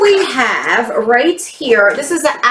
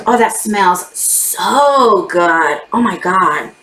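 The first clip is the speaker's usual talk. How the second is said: savoring a smell; 5 semitones lower, slower than usual. Real, not acted. Sniffing the new candle.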